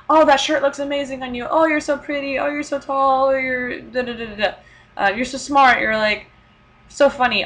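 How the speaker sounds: mock sweet flattery, quick and bright; imitating gushing compliments. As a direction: gush like a Korean auntie, lightly.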